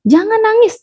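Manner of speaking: high, acted scolding; parent forbidding tears. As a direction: tell off a crying child in mock reproof. Parent forbids a child to cry. (larangan orang tua bernada tinggi)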